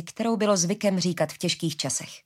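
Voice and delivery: ordinary delivery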